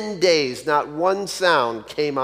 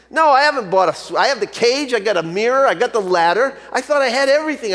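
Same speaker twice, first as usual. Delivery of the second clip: higher, faster, flustered complaint; exasperated; 6 semitones higher, 3 dB louder, faster than usual. Acted. Frustrated bird owner tells the shopkeeper, flustered and exasperated. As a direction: pour out the list of everything you already bought.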